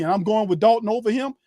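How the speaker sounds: ordinary talk, nothing marked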